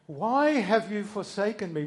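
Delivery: desperate loud lament; anguished cry. Retold or imitated. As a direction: cry it out as Jesus on the cross.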